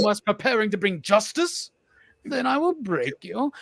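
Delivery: theatrical villain voice, pitch swooping up; mock movie-villain threat; Bane. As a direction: put on a grand masked-villain voice. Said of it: "And he goes".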